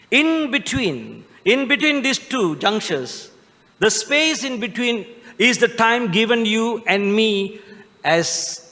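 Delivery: high, slow, ringing homily voice; preaching at full force. Acted. Priest preaching to his congregation at Christmas, ringing and slow. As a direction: proclaim it slowly from the pulpit.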